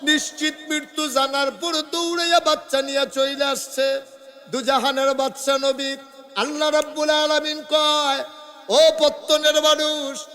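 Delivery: high, impassioned chant; full-force preaching. Acted. Preacher telling of God addressing mankind, high impassioned chant.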